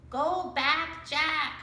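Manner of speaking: "Go back, Jack" said like a person, not a bird. A narrator's reading. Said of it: "She sounded like a person".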